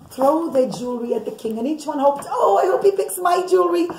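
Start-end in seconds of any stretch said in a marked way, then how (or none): none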